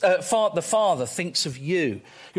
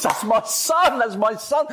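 higher-pitched, delighted boasting about a son; proud father; 5 semitones higher than usual. Acted. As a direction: exclaim it like a bursting-proud father. Voicing the delighted Father praising his Son.

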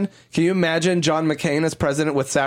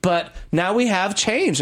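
normal talking voice

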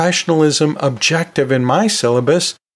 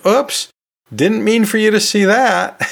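higher, sheepish caught-out voice; mock embarrassed oops; 8 semitones higher than usual. Caught out on camera in front of the class, higher and sheepish. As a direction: play someone who just got caught on webcam, higher.

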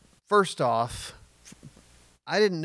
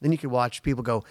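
usual speaking voice

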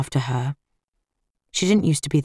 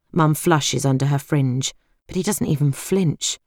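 plain reading voice

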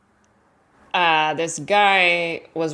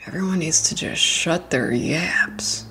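whisper; 4 semitones lower, 3 dB quieter than usual. Real, not acted. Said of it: "including me".

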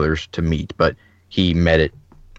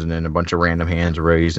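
ordinary talk

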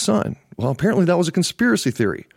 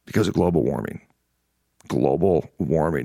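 mocking sneer; 12 semitones lower than usual. Scoffing at climate policy talk.

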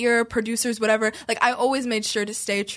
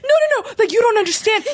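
panicked; 8 semitones higher, 4 dB louder, faster than usual. Retold or imitated. Friend panicking in the shower.

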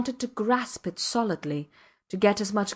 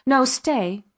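higher and louder plea; crying out; 4 semitones higher, 6 dB louder than usual. A narrator's reading. Man crying out to keep her from leaving. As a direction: call out to stop her. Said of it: "he cried".

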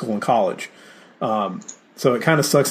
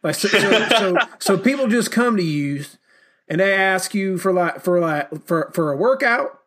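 put-on a dad puzzling over online work, in a Southern accent; Southern dad impression; 5 semitones higher than usual. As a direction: imitate your Southern dad's accent, pitched up. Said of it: "my dad kinda sounds like this".

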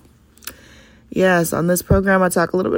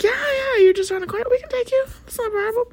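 imitating classmate; much faster than usual. Voicing classmate's kind offer.

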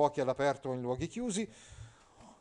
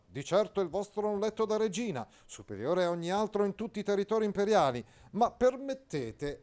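high, loud pompous knight; Agilulfo pompous; 7 semitones higher, 3 dB louder than usual. Acted. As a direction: declaim like a stiff courtly knight. Agilulfo answering widow. (Agilulfo, cavaliere pomposo, acuto e forte)

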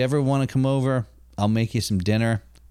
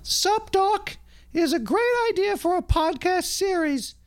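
Canadian woman's voice, pitched way up. Pretend. Reading Boing Boing's quote as a Canadian woman from Project Grizzly, high-pitched. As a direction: squeak it out like a Canadian lady from the sidelines. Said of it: "a Project Grizzly Canadian woman".

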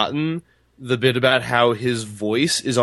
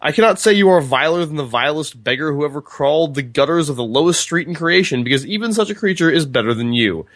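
raised, declaimed storybook insults; higher-pitched formal tirade; reading a character's tirade; 4 semitones higher than usual. A narrator's reading. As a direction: read it out theatrically, relishing each insult. Reads Roland's insult speech from book.

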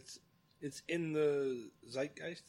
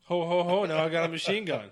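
loud, higher jolly Santa with a laugh; mock Santa; 4 semitones higher, 7 dB louder than usual. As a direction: boom it out like a gun-toting Santa. Jokes Die Hard Santa line.

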